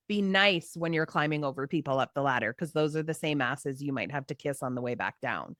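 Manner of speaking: lightly voiced dad passing on wisdom; a hint of dad. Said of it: "My dad used to always say".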